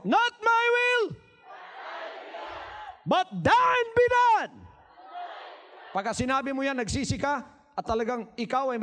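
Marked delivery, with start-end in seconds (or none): 0.0-4.5 high, slow shout of surrender; louder. Shown as a demonstration. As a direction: shout it louder and slower for all to hear. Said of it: "laksan nyo".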